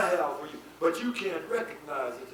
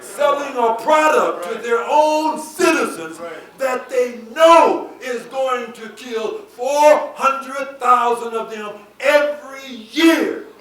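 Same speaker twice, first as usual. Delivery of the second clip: loud, high, drawn-out preacher's cry; slow, emphatic pulpit shout; full-force preaching; 7 semitones higher, 12 dB louder, slower than usual. Real, not acted. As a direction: preach it with indignation, leaning on the big words.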